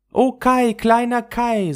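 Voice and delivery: high, loud longing sigh for her friend. Acted. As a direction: cry out his name in yearning. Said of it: "seufzte Gerda". (Gerda seufzt laut und hoch nach Kai)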